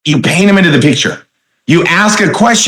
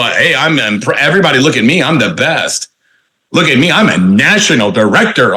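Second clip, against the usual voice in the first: low-pitched self-important show-off; mock boasting; 4 semitones lower than usual. Trainer mocks bragging leaders, low boastful voice. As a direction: puff it up like a pompous big shot. Mimics a boastful big shot.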